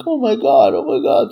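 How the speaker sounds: high, loud mocking panic; hammed-up frightened gasps of oh my God. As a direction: mimic people freaking out, squealing. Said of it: "people be like".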